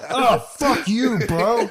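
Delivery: slow, high mock-angry groan; imitated angry wife. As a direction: drag it out, imitating someone woken up furious. Re-enacting wife's angry waking outburst.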